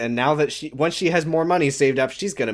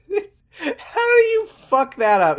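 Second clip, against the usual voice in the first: high-pitched, cracking up; laughing disbelief; slower than usual. Real, not acted. Co-host mocks a botched scene to their partner, laughing in disbelief. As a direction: squeeze the words out through real laughter.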